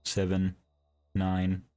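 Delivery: flat, lifeless number reading; monotone depressed voice. Retold or imitated. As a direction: read each digit with no feeling at all. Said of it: "reads a series of numbers in a monotone, depressed-sounding voice".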